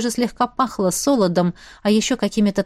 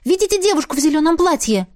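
higher-pitched, excited question; 7 semitones higher, faster than usual. A narrator's reading. Heroine points out her maid to the duke, excited. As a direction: eagerly draw someone's attention. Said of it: "Я на эмоциях толкнула Дарри".

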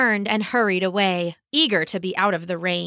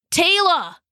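higher, urgent call of a name; startling call; 9 semitones higher, 4 dB louder than usual. Acted. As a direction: burst in calling your sister. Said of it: "scaring her so badly".

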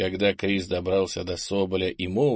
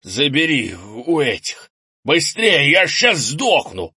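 loud, slow, higher, building in desperation; urgent strained shout; 6 semitones higher, 7 dB louder, slower than usual. A narrator's reading. Wounded grandfather urges grandson to hurry, strained shouting. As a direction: push the order out as if dying.

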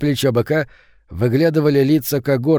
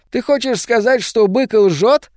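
high-pitched, goading menace; henchman's squeaky threat, drawn out at the end; threatening challenge; 10 semitones higher, 4 dB louder, slower than usual. A narrator's reading. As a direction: dare him with a raised, needling question. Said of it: "грозно надвинулся Росвеер".

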